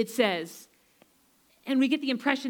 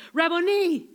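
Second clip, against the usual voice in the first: higher, joyful cry of recognition; cried out; 5 semitones higher, 3 dB louder than usual. Retold or imitated. Mary recognizes Jesus, crying out with joy. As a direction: call it out with sudden joy. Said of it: "she cried out in Aramaic".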